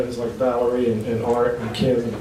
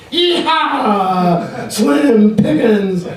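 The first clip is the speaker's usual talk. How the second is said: high, loud, drawn-out whooping shout; cowboy holler; 9 semitones higher, 6 dB louder, much slower than usual. Acted. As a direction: let out a rodeo yell mid-poem. Poem bursts into cowboy holler.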